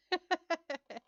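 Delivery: bright high burst of laughter; short high real laugh; high giggle. Real, not acted. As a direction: let a high giggle escape. Laughing after teasing next stream.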